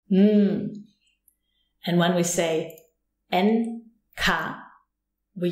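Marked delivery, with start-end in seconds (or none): 0.1-0.8 "Mm" as a long hummed ng from the back of the mouth; one back-of-mouth sound. Shown as a demonstration. Teacher repeating where it comes from, one sustained ng sound. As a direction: hold Mm as a single nasal ng. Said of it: "It comes from here".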